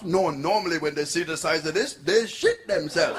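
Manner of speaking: put-on punchline in a broad Jamaican accent. Chalky boasts to the prostitute, Jamaican accent. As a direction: deliver it Jamaican-accented, stretching the last words. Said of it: "This is my Jamaican accent now".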